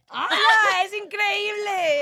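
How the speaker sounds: high, loud, slow cry of delight; real thrilled yell at the gadget; ecstatic shout. Real, not acted. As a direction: squeal at how amazing it is. Reacting to the button sound.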